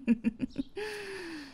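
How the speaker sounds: high, falling laugh; high amused laugh. Acted. Laughing before seeing the guest at lunch.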